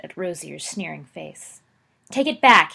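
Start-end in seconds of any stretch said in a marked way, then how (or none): 2.1-2.7 furious loud yell. Acted. Sirius yelling at Rosier, wand drawn, furious. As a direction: shout it at his face. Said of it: "he yelled".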